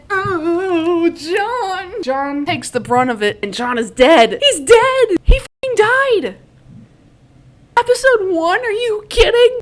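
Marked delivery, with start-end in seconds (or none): 0.1-2.0 high, drawn-out wail of grief; mock wail. Recapper mourning John's death on the show, mock wail. 4.4-9.6 high, loud, disbelieving yell; shocked outcry. Real, not acted. Fan reacting to John dying in episode one, shocked outcry. Outraged at character's shock death.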